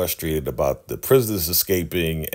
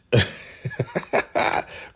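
silent wheezy laugh; breathless wheeze of laughter; airless laugh; 5 semitones higher than usual. Real, not acted. Breathy laugh after the punchline.

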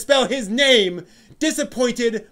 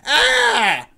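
sudden higher, louder exasperated cry; mock anguished yelp; 6 semitones higher, 5 dB louder than usual. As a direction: let out a hammed-up shriek of despair. Mock anguish, pulling hairs.

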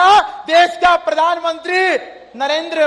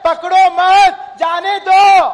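higher and louder, a ringing declaration; louder, higher, forceful preacher's proclamation; God's word proclaimed; 5 semitones higher, 7 dB louder, slower than usual. Retold or imitated. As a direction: proclaim it as the Lord's own command. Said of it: "हमारे परमात्मा कहते हैं".

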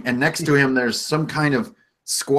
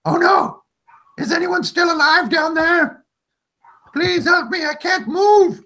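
high-pitched character pleading for rescue; voice calling for help. Acted. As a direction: squeal out a desperate, hammed-up plea from afar. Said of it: "you hear this voice up above around this little bend going".

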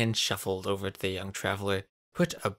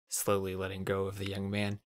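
ordinary delivery

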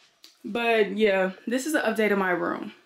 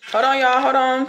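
louder call to wait; louder, hurried hold on; 4 semitones higher, 6 dB louder than usual. Real, not acted. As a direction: call out to hold on while fixing the camera. Fumbling with camera, calling out.

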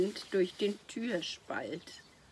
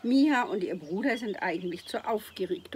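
plain reading voice

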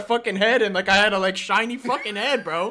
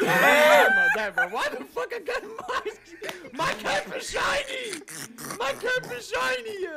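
laughing; 10 semitones higher, slower than usual. Real, not acted. Laughing at shiny cap.

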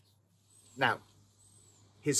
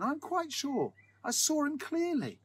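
high, insistent voice. A narrator's reading. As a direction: insist he saw it. Sexton insists he saw ghost.

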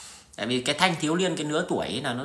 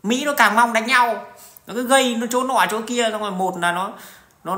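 normal talking voice

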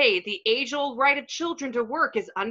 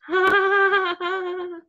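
creepy giggle; 6 semitones higher than usual. Acted. Laugh in video.

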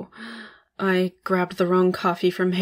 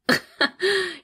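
high falling cackle of a gloating boss; gleeful high cackle; 12 semitones higher than usual. Acted. Boss gloating at her new hire, high falling cackle. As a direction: cackle like you've already won.

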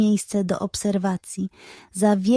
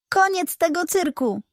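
higher-pitched mock growl; 7 semitones higher than usual. Detective shuts down the charlatan's show, higher mock growl. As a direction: snap it out like an angry detective. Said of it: "warknął".

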